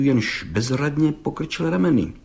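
ordinary delivery, nothing marked

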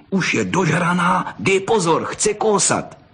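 put-on high-pitched boyish alarm; excited boy sounding the alarm; excited warning. A narrator's reading. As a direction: warn your friend, pitch climbing. Boy warns friend about angry fox.

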